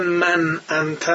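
من انت said very slowly, min inta; Egyptian Arabic, slow. Retold or imitated. Teacher imitating Egyptians saying من انت.

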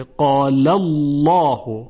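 قال الله slow, with a thick dark l in Allah; heavy lam. Shown as a demonstration. Teacher giving the fatha example, slow heavy قال الله. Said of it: "لام الله تفخیم بشه".

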